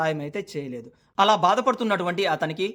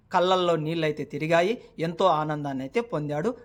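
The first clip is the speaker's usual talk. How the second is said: ordinary talk, nothing marked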